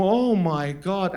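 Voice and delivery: slow, drawn-out amazement; mock awe, very slow; awestruck kid. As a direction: stretch it out in wonder. Child awed by tanks.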